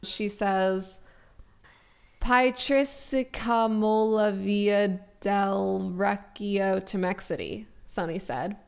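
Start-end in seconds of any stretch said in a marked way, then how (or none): 2.2-7.7 slow, deliberate Italian-sounding phrase; careful foreign phrase. Shown as a demonstration. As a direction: sound out each word carefully. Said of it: "I'll try my best to pronounce it correctly".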